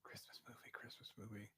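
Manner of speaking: whisper. Real, not acted. Whispers the hint to himself.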